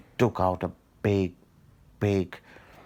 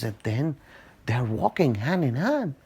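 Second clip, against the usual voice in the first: usual speaking voice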